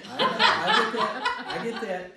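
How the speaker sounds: high laughing burst; a loud, high laugh on I get that. Real, not acted. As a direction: laugh as you concede it. Laughing concession.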